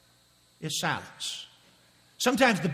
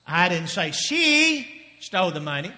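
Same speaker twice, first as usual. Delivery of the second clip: "she" rising and louder; stress on she; 5 dB louder than usual. Shown as a demonstration. Preacher showing a new meaning, stress on she. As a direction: hit "she" with a questioning lift. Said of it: "Let me say it to you in different ways".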